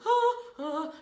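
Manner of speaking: short high, loud mock-panic gasps; panicked cries. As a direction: imitate someone panicking in another room. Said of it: "then I hear panic".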